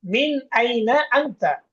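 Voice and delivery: slow, careful Arabic question; slow model reading. Shown as a demonstration. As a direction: spell out each word slowly for learners. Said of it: "মিন মানে হচ্ছে থেকে". (ধীরে, স্পষ্ট করে আরবি প্রশ্ন)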